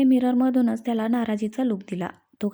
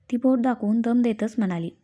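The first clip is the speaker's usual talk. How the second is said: plain reading voice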